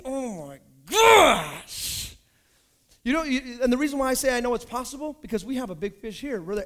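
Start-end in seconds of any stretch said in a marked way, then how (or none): 0.0-2.2 slow, loud, stretched "oh my gosh"; excited drawn-out exclaim. Real, not acted. Preacher imagining a friend saved, stretched excited exclaim. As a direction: savor the thrill of it.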